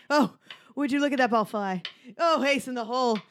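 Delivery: posh British sports announcer; ping pong commentator. Acted. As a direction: call the shot like a British commentator. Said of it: "It's a ping pong commentator".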